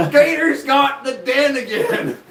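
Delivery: high, loud, slow Southern holler; Melissa. Retold or imitated. Host mocking a friend's angry Southern accent, loud drawl. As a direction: yell like a mad Southerner, hammed up. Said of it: "why does she have a southern accent when she's mad at me".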